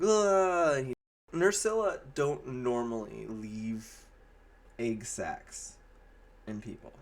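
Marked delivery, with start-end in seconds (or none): 0.0-0.8 mock groan. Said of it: "and he's like".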